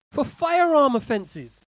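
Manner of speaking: high, loud, drawn out on "firearm"; incredulous outburst. Real, not acted. Host shocked at gun criminals let off, loud and incredulous. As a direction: blurt it out slowly in disbelief. Outraged at cautions for guns.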